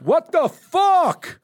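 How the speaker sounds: drawn-out, high, loud "what the fuck"; angry shout. Retold or imitated. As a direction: yell it slowly at the speeding car. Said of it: "You're angry".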